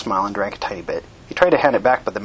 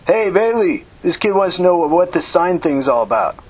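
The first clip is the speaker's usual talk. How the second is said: raised, high-pitched call; 7 semitones higher, 4 dB louder than usual. Acted. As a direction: get a busy friend's attention across the group. Calls to Bailey across the group.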